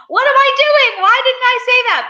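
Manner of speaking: high, loud comic despair; retold frantic squeal to a friend; mock panicked wail. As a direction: wail it in exaggerated regret.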